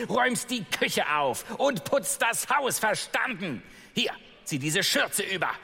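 hohe, herrische Stimme einer bösen Stiefmutter. Acted. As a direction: kommandiere das Mädchen von oben herab. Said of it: "rief die Stiefmutter nach ihr". (Stiefmutter scheucht Ella herum, hoch und herrisch)

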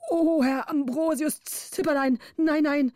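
put-on high, pleading old man's voice; frightened councillor. A narrator's reading. Councillor pleads in fear at the bridge, high and pleading.

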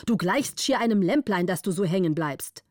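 ordinary delivery

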